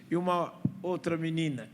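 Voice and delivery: ordinary delivery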